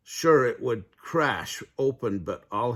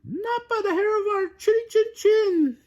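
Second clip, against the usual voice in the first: very high, loud pig squeal; shouting piglet; 6 dB louder than usual. A narrator's reading. Pete defying the wolf in a loud high squeal. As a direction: shout it back as a defiant piglet. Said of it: "Pete shouted".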